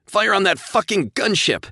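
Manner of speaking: high, loud furious command; furious order. A narrator's reading. Pilot ordering his gunners, angry shout. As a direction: bark the order with fury. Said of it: "Josh ordered his gunners".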